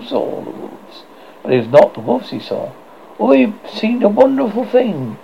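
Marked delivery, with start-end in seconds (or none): 3.2-5.2 high, swooping cry of wonder. A narrator's reading. Nibs reports his sighting to the boys, crying out. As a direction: exclaim with the pitch lifted high. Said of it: "he cried".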